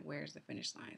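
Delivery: low, soft, breathless whisper; whispered repeat. Retold or imitated. Runner on the last stretch whispers to herself, exhausted. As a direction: whisper it to yourself, spent.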